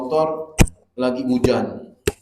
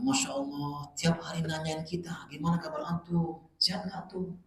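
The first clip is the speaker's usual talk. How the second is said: sweet-talking debtor; 4 semitones higher, 8 dB quieter than usual. Retold or imitated. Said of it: "niatan untuk ngutang sama kita".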